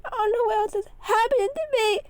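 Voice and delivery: high, loud tearful wail; bawling. A narrator's reading. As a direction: break down crying. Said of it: "bellow into tears".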